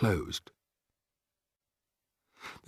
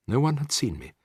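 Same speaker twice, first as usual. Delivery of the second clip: usual speaking voice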